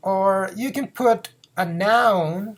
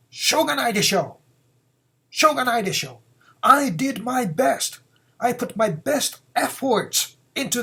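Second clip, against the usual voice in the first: higher and louder defiant retort; defiant angry voice; 5 semitones higher, 3 dB louder than usual. Acted. Blamed person defiantly hitting back in anger. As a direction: snap back at the person blaming you. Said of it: "With an angry voice".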